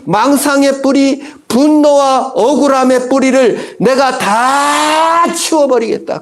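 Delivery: slow, high, solemn proclamation; voice of God. Acted. God promising believers to uproot their doubt, slow solemn proclamation. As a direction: declare forgiveness slowly and grandly.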